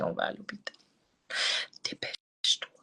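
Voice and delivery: « On va à l'hôpital » chuchoté, pressant; ordre chuchoté. Retold or imitated. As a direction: souffler l'ordre à la mère, tout bas. (ordre soufflé à sa mère, pressant)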